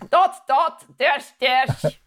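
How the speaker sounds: hammed-up German accent; mock German accent. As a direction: mimic the attempt as exaggerated German. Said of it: "You sounded very German then".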